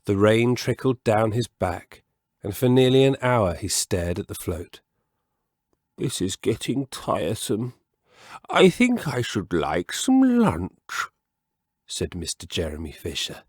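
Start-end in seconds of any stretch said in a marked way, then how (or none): 6.0-11.1 put-on a bored old frog wanting lunch; lightly whiny frog; frog character voice. Mr Jeremy Fisher's voice.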